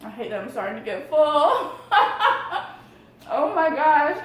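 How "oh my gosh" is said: high, loud burst of laughter; laughing. Real, not acted. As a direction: laugh through the words. Laughs at getting full.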